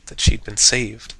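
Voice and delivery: plain reading voice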